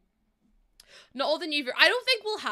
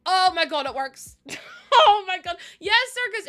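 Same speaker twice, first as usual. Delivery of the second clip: much louder, high cry of relief; excited relief; 7 semitones higher, 11 dB louder than usual. Real, not acted. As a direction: celebrate that it finally works. Build finally works.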